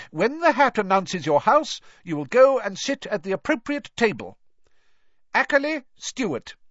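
high, projecting teacher voice; professor's announcement. A narrator's reading. Professor calling up the first student, high and formal. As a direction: announce it to a full hall.

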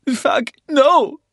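high, loud panicked cry; screaming in pain. A narrator's reading. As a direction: scream as if stabbed. Stabbed man cries out.

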